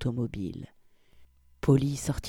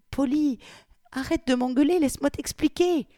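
much higher, begging voice; frightened pleading; 11 semitones higher than usual. Acted. Victoria begs Polly to stop yelling, high and frightened. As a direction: beg him to stop shouting.

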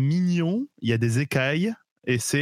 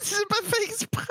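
squeaky high voice effect; chipmunk voice changer; 4 dB quieter than usual. Real, not acted. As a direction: laugh pitched way up by filter. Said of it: "c'est le modificateur de voix".

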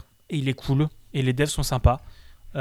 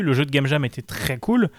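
normal talking voice

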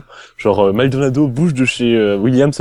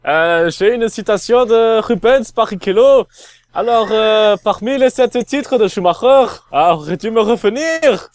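put-on German accent, pitched up high; 8 semitones higher than usual. Asked for a German accent, he reads the quote in mock German accent. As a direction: read it like a hammed-up German. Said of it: "Accent allemand, accent allemand".

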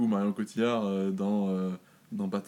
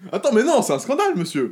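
mock outrage; 7 semitones higher, 6 dB louder than usual. Mock outrage at quiz rule.

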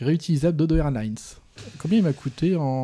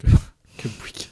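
whispered through a laugh; 4 dB louder than usual. Real, not acted. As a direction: breathe it out, amused. Amused echo of old expression.

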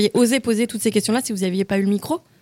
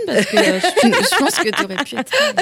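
laughing; 5 semitones higher than usual. Real, not acted. Teasing colleague while laughing.

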